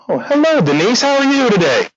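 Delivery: bright, high sing-song hello; fake cheerful greeting. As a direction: play it sugary-sweet to the upset client. Overly cheerful greeting to client.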